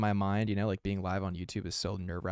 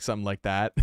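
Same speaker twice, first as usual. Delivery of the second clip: normal talking voice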